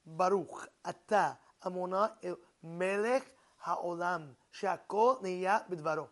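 slow, deliberate blessing, each word separate; measured recitation, word by word. Shown as a demonstration. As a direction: pronounce each word of the blessing slowly and distinctly. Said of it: "word by word".

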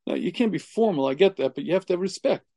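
normal talking voice

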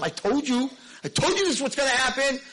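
high and loud, whining told-you-so; exasperated complaint. Retold or imitated. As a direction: complain like a vindicated kid. Moshe complaining to Hashem.

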